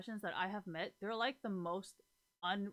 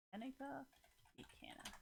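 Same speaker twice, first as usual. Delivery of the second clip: hushed name; 4 semitones higher, 15 dB quieter than usual. Real, not acted. Hesitant naming of the club.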